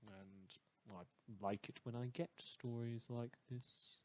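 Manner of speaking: usual speaking voice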